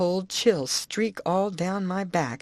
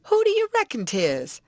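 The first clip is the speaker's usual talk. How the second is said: much higher, gleeful tease; teasing aunt; 3 dB louder than usual. Acted. Aunt to husband, much higher gleeful tease.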